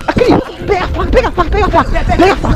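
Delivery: high panicked shout; urgent shout. Real, not acted. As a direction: yell for the knife in panic. Urgent cry for the knife.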